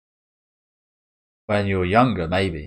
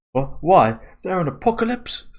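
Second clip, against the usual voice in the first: much higher, incredulous; mock disbelief; 12 semitones higher, 4 dB louder than usual. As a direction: scoff in mock alarm. Daughter reacting to strange orders.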